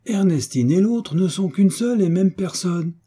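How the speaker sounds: high, proud announcement of the answer; triumphant realization. Acted. The baron declaring the solution to the hunchback, high and triumphant. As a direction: proclaim it as if you solved it. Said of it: "triomphant à son tour".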